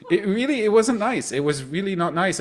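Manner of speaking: ordinary talk, nothing marked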